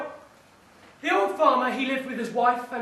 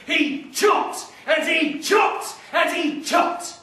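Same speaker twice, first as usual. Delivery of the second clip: each "chopped" hit harder, slow and loud; rhythmic chopping; 5 semitones higher, 4 dB louder, slower than usual. A narrator's reading. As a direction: swing the words like axe blows.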